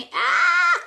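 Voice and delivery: loud, high "aah"; sudden piercing yelp; startled scream. Acted. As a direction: scream as if a fly attacks. Screams at a fly.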